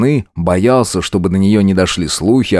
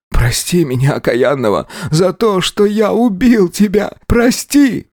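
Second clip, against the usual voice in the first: rising into a high, slow, frantic plea; gasping for air; 10 semitones higher than usual. A narrator's reading. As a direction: beg for forgiveness, panicking. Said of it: "зашептал". (задыхающаяся мольба, срываясь вверх)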